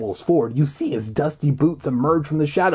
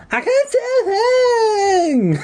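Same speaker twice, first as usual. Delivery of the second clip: squeaky cry; 4 dB louder, slower than usual. Acted. Character cries out in story.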